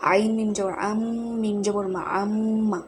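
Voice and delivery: আম্মা with the m hummed through the nose; nasal gunnah. Shown as a demonstration. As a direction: hold the ম in আম্মা as a long nasal hum. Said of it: "তাসদিদ থাকবে তখন গুণনা করে".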